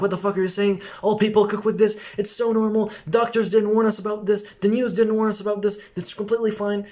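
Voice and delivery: high-pitched, indignant rant at a steady pitch; mock mother's outburst, pitched well up; imitated mother shouting. Mother shouting back at son about the pans, high indignant protest. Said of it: "she shouted at me".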